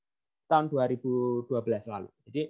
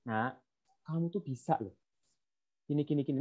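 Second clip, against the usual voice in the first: soft, hushed coaxing to a pupil; softer, like reassuring a struggling kid; gentle encouragement; 8 dB quieter than usual. Retold or imitated. Chemistry teacher softly encouraging a struggling pupil, gentle.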